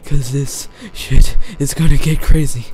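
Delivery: loud, overdone breathing instructions; hammed-up self-coaching. Gamer coaching himself for the chaos, hammed-up panic. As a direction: coach yourself through panic, playing it up. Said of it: "Let's stop with the act".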